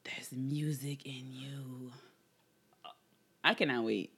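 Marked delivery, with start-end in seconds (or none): 0.0-1.9 soft whisper. Pretend. Echoes the song title dreamily.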